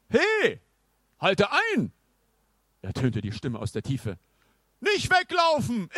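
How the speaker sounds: put-on high, pompous old knight. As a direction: announce yourself like a grand knight. Knight calls after Nils.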